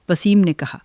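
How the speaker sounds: usual speaking voice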